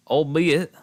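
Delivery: albeit said goofily, lifted in pitch; silly-sounding albeit. Real, not acted. Streamer tries the word for chat, silly-sounding albeit. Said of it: "I said it stupid".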